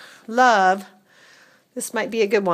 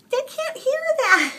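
high, loud, laughing squeal; laughing protest; 5 dB louder than usual. Real, not acted. Owner getting the cat to purr into the phone, laughing protest. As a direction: protest through a laugh, pitched way up. Laughing at cat's purring attempt.

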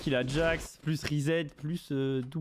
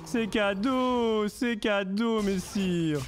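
higher, sing-song mock courtier offering a gift; 4 semitones higher, slower than usual. Streamer mocking the enemy team as a courtier, sing-song and higher. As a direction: play the fawning servant bowing to his lord.

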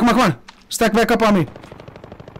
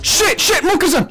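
excited; 10 semitones higher than usual. Real, not acted. Under fire.

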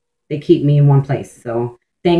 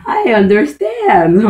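higher, slow, put-on voice of a fellow bipolar viewer; imagined viewer agreeing; 5 semitones higher, much slower than usual. Imagined bipolar viewer answering her video, in a slow, higher put-on voice. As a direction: coo it slowly and higher, like someone nodding along. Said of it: "they'd be like".

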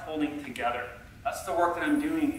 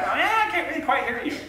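high-pitched call from the back; mock audience member calling out; imitating back-row listener; 11 semitones higher than usual. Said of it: "Someone's back".

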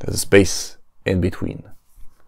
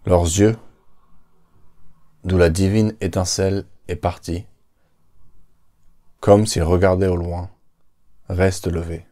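poem recitation. Acted. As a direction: recite it like a solemn poem on a stage.

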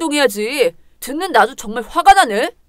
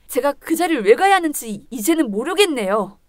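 ordinary talk, nothing marked

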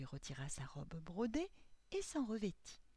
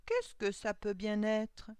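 put-on slow, loud, higher old man's question; suspicious Coppelius; 4 semitones higher, 7 dB louder, slower than usual. A narrator's reading. Old clockmaker hears a knock, slow suspicious question. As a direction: ask it slowly and warily in an old man's voice. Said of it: "marmonna Coppelius se méfiant".

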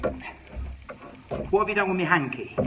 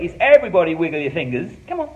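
ordinary talk, nothing marked

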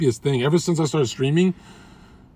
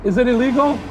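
yelling over leaf blower; 9 semitones higher than usual. Real, not acted. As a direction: call out over the noise. Shouting to worker over blower.

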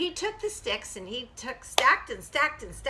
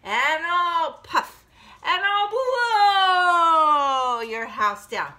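loud wolf threat, swelling higher and longer; threatening big bad wolf; 5 semitones higher, 7 dB louder, much slower than usual. Wolf threatens the piggy, loud, slow and building. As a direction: build each threat, stretching the key word hugely. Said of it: "And the wolf says".

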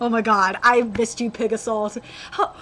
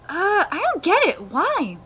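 usual speaking voice

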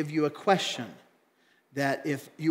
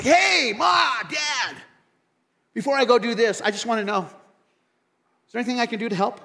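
high, loud cheerful call to parents; eager kid at home; 4 semitones higher, 3 dB louder than usual. Pretend. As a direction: burst into the kitchen eager to help. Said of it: "You're like".

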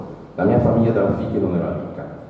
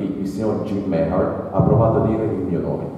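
plain reading voice

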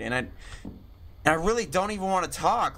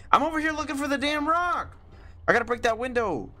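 high, rushed and frantic; 10 semitones higher than usual. Real, not acted. Gamer being chased, frantic and pitched high. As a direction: blurt it out in a panicked hurry.

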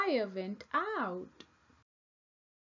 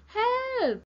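high, loud storybook scream; very high-pitched, loud call for rescue; screamed cry for help; 14 semitones higher, 9 dB louder than usual. A narrator's reading. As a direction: shriek it out in alarm. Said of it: "screamed Panda".

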